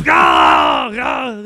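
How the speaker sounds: loud hammed-up action-hero yell; Arnold Schwarzenegger. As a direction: roar a mock electrified "gah". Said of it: "you always have to end with a".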